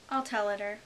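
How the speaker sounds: ordinary delivery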